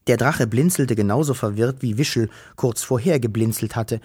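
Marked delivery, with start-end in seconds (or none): none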